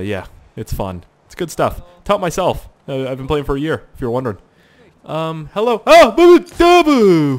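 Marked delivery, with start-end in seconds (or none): 5.9-7.4 high loud hooting "boo"; mock guard yell. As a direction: hoot like a panicked soldier. Mocking a shouting guard.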